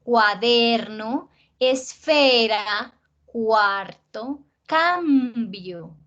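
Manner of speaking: palabras lentas, alargando la penúltima de "Cuaderno", "esfera", "dinero"; acento grave exagerado. Shown as a demonstration. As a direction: estira DER en "Cuaderno", CUEN en "encuentro", PA en "zapatos". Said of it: "sí tienen el acento ahí en el azulito". (lista pausada, cada penúltima sílaba marcada como en "vestido")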